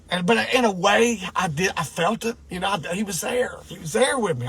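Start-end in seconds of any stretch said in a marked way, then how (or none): none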